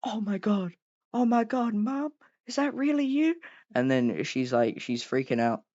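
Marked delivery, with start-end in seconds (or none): 0.0-3.4 high-pitched mock excitement; put-on high, thrilled voice; imitating excited Mia. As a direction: act out her excited gasping disbelief, way up high. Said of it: "Mia starts getting really excited".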